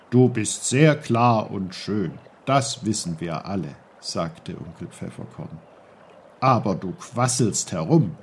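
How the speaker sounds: lightly voiced patient old uncle; low, patient old uncle. Uncle teases brook.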